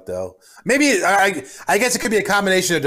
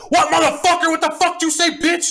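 retold angry yelling; 12 semitones higher than usual. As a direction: yell it out the car window. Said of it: "I'm yelling out the window".

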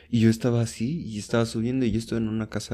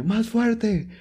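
high giddy yell for more; kid yelling; 10 semitones higher, much slower than usual. Retold or imitated. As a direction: demand it like a kid who won't stop. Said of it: "y el niño".

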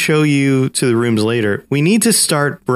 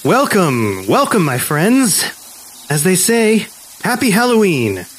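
booming sing song welcome; 5 semitones higher than usual. Acted. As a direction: welcome them like a showman host. Host greets guests theatrically.

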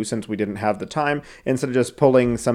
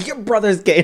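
mock scolding mom; 8 semitones higher than usual. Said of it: "I saw that one".

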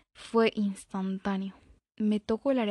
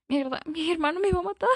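panicked; 8 semitones higher, 3 dB louder than usual. Acted. Chica asustada por la hora.

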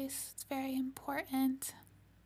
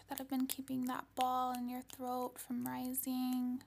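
whispered guided meditation. Acted. Meditation guidance.

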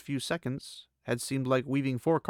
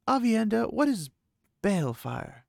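lightly voiced young woman; 10 semitones higher than usual. Egwene voiced, asking.

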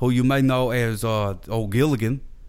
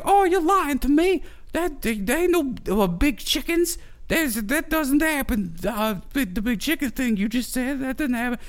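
much higher, put-on girly voice; girl's disbelief; 13 semitones higher than usual. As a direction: scoff at him in a high girly voice. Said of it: "she's like".

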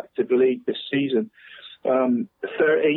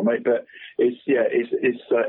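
ordinary talk, nothing marked

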